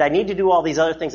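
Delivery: fast, hurried list of excuses; rushed self-quote. Retold or imitated. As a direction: rattle it off like a busy man. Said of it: "what have I just said when I said".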